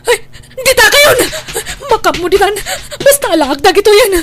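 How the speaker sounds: loud, high, frantic cry; panicked shout. Acted. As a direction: yell in panic while running off. Caught thief panics, flees.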